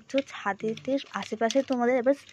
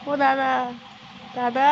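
mock-polite wake-up call; 2 semitones higher than usual. Mock-formal waking sleeping friend.

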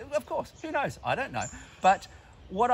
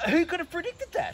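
fake surprise; 8 semitones higher than usual. Sarcastic mock surprise at lockdown.

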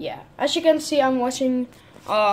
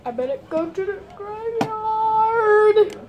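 high mock voice; 8 semitones higher than usual. Mock spooky line in Sims play.

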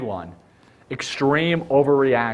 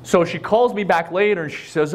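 normal talking voice

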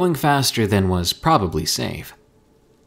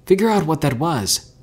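higher, louder, urgent command; urgent order; 7 semitones higher, 5 dB louder than usual. A narrator's reading. Herschel orders his friend to call Porter, urgently. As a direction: fire off an alarmed order.

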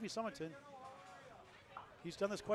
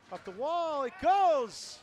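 much higher, much louder yell as the pin falls; thrilled play-by-play shout; excited call; 8 semitones higher, 12 dB louder, much slower than usual. Real, not acted. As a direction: erupt as the spare drops. Calling a lucky spare.

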